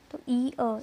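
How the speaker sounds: ordinary talk, nothing marked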